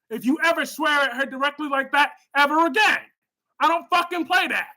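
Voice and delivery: real anger at a chatter swearing at Melanie; angry scolding. Real, not acted. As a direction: lay down the law, genuinely mad. Angrily scolding chat member Spider-Quake.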